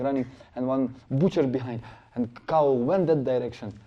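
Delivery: ordinary talk, nothing marked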